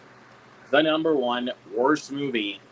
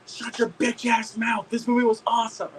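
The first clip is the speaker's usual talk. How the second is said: high, slowed-down scolding; mock indignant fan; 11 semitones higher than usual. Fan telling off the heckler, mock indignant.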